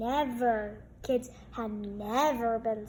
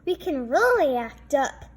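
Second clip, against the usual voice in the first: louder, eager; eager kids; 2 semitones higher, 8 dB louder than usual. A narrator's reading. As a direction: relish the mischief. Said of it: "said the kids".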